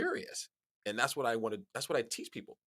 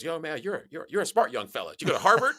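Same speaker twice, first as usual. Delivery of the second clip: put-on louder, rising, delighted old barfly; impressed drunk; 8 semitones higher, 12 dB louder than usual. Drunk stranger at the bar praising the young man, loud. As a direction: gush like a tipsy stranger won over. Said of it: "he goes".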